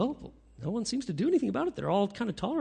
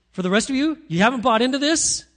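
loud, lifted, higher pitched proclamation; Jesus encouraging the faithful; 5 semitones higher, 6 dB louder than usual. Acted. Jesus speaking to the faithful rest, loud fervent proclamation. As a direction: call out to the faithful with fervour. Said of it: "He says".

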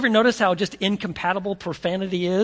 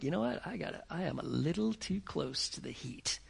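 much softer, under the breath; muttering to himself; 3 semitones lower, 13 dB quieter than usual. Acted. Peter retreating from the fire, soft aside to himself. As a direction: say it to yourself, nobody else hears.

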